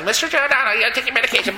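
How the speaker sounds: high-pitched imitation of a nurse; put-on high nurse voice; mock nurse. Voices a disrespectful hospital nurse.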